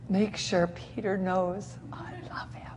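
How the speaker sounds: slow, soft whisper; tender whisper. Retold or imitated. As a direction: breathe it tenderly. Tender message retold.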